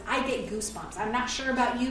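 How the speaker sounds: normal talking voice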